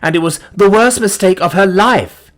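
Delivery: mock-pitying, high and loud; sarcastic. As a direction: drip sarcasm. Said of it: "he added sarcastically".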